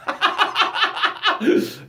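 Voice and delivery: high breathy giggle; laughing. Real, not acted. Laughing at his mom's help typo.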